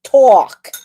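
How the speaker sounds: "Talk" with a rounded New York "aw", loud; New York accent. Shown as a demonstration. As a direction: punch out "Talk" as "tawk". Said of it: "that's how we talk".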